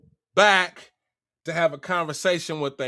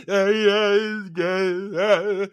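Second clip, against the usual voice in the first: imitated wailing sobs; mock crying; slower than usual. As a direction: wail wordlessly like a man crying, hammed up. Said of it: "They hear him crying".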